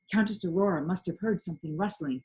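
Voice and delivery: ordinary delivery, nothing marked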